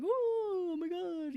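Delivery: high, drawn-out collective "ooh"; mock group reaction. Group reacting to who was their best date, mock swooning.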